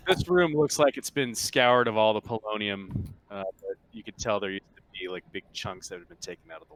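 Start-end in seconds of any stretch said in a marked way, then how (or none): none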